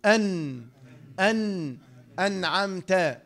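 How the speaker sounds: "أن" and "أنعمت" with the ن humming through the nose; nasal noon. Shown as a demonstration. Teacher drilling the noon, nasal hum in "أن" and "أنعمت". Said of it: "من الخيشوم".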